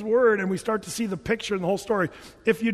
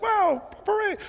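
put-on high childlike wow parade; imitating pastor; 13 semitones higher than usual. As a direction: pipe it up like a delighted child. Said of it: "He's just kinda like".

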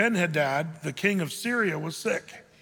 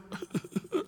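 high, soft mock sobbing; badly faked little boo-hoo sobs; mock crying; 12 semitones higher, 7 dB quieter than usual. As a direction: pretend to burst into tears. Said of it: "Elijah starts crying".

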